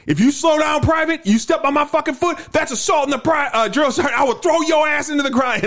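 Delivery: high yelling drill sergeant. Retold or imitated. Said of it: "And he'd be like".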